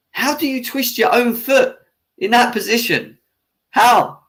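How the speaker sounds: real angry rant rising to a shout; exasperated outburst. Real, not acted. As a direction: demand an answer in disbelief. Ranting at rival fan's comment.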